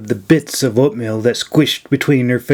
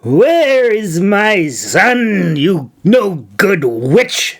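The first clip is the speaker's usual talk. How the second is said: put-on higher, slow old woman's angry demand; 5 semitones higher, much slower than usual. A narrator's reading. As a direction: drag out the accusation like a furious crone. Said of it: "she demanded".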